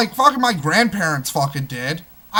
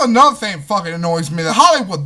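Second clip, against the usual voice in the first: normal talking voice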